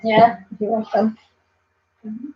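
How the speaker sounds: normal talking voice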